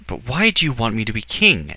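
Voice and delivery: put-on high, loud, insistent boy's question; persistent questioning. A narrator's reading. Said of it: "Edward persisted".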